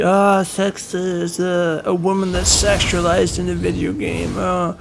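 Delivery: mock outraged complainer. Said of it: "Everybody would be".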